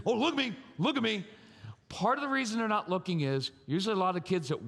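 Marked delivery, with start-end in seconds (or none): 0.1-1.2 higher-pitched mock parent insisting on attention; demanding dad. Dad imitated for the men out there, demanding. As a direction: imitate a dad pressing for eye contact. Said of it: "Okay, so we do this".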